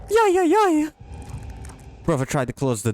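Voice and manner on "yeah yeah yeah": high, loud panicked squeal; frantic yelp of yeah yeah yeah. Real, not acted.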